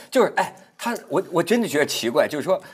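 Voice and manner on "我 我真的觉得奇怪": ordinary talk, nothing marked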